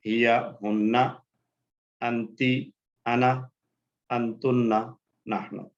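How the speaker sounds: word-by-word chant with a pause after each; repeat-after-me chant; drill recitation. Shown as a demonstration. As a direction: lead the class through the list, three rounds. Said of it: "दोहरा लीजिए मेरे साथ".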